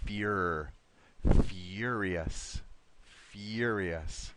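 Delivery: "Fur" set apart, then "Furious" said in full and clearly; model pronunciation. Shown as a demonstration. Teacher models "Furious" for Rocio, first part clearly. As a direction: stress the "Fur" start of "Furious". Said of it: "let's try the first part one more time".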